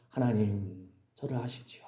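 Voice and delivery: 외로운 다윗의 기도를 낮고 작게; 나직한 기도. Acted. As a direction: murmur a hushed, intimate prayer to God, acted. 다윗이 홀로 드리는 기도를 연기. (나직이 읊조리는 기도)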